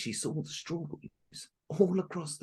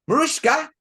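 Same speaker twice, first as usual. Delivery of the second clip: high, loud shouted name; 13 semitones higher, 14 dB louder than usual. Acted. Stepmother shouting for Marushka, loud and high. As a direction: bellow the name across the house. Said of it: "shouted the stepmother".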